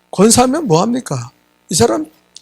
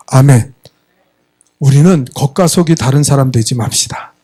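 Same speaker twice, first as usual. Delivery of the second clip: ordinary talk, nothing marked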